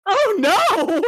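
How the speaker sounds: high, loud cry of dismay. Real, not acted. Player hearing the weird color is chartreuse, loudly dismayed. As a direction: exclaim in comic horror.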